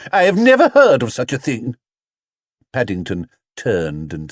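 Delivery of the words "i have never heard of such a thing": higher and louder, outraged shop assistant; indignant exclamation. A narrator's reading. Assistant outraged at cutting a hat, loud and high. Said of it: "he exclaimed".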